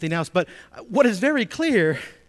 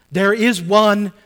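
much louder and slow, each word weighted; proclaimed; 2 semitones higher, 9 dB louder, slower than usual. Acted. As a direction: proclaim it slowly to the congregation. Preacher repeats key phrase.

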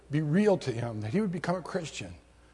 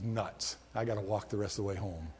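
muttered, fast and softer grumble; grumbling aside; 7 semitones lower, 7 dB quieter than usual. Retold or imitated. Stranded hitchhiker grumbling to himself, muttered fast. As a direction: grumble it under your breath, quickly.

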